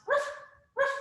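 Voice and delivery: two short dog barks; doggy sounds. Shown as a demonstration. Teacher showing kids down dog, short barking. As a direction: ruff briefly like a dog. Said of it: "make some doggy sounds".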